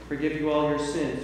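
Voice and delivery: ordinary talk, nothing marked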